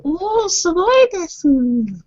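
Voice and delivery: high, slow squealing fan voice; excited anime fan. Pretend. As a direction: gush like an anime fangirl. Joking anime Japanese.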